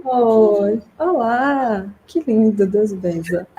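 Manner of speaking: high, singsong hello with a laugh at the end. Real, not acted. Therapist admires the child who just woke up, high cooing voice. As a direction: gush sweetly over a little one.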